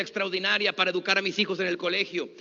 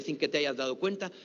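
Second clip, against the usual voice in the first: ordinary talk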